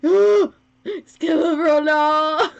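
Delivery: gasp, then a slow, loud "Skelepro, no!"; gasping surprise. Real, not acted. Streamer gasps at the donor's gift, loud and stretched. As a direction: drag it out in disbelief. Gasps at yet another donation.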